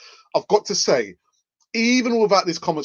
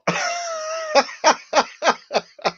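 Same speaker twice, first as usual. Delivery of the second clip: shrieking laugh; high burst of laughter. Real, not acted. Fan laughing at his own Holding joke, high shriek. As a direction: crack up high.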